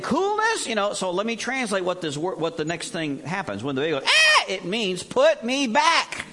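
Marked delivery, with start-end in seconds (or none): 4.1-4.4 sudden very high, loud wail "Ah"; newborn's cry. Pretend. Preacher imitating a newborn's first cry, high wail. 5.0-6.3 high, loud and slow, stretching each word; pleading newborn. Pretend. Preacher voicing a newborn, loud slow plea. Translating the baby's cry.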